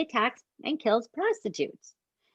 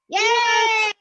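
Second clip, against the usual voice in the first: mock cheer; 12 semitones higher, 6 dB louder than usual. Teasing cliffhanger cheer.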